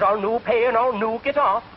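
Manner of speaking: put-on defiant Scottish accent; stubborn Scotsman. Stubborn Scotchman in joke.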